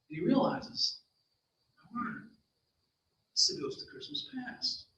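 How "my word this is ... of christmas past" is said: hushed, breathless realization; awed whisper. A narrator's reading. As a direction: whisper it in awe. Boy realizes ghost is there.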